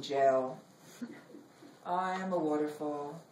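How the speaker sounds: measured, expressive poem reading with long pauses; poetry reading. Acted. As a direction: read it like a poet on stage, savoring each image. Reads her poem aloud.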